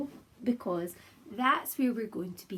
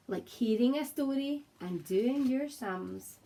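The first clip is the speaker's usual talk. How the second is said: lively storybook read-aloud in rhyme; reading to children. Acted. Teacher reading a picture book aloud to her class, lively and gentle. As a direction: read it out like bedtime for little ones.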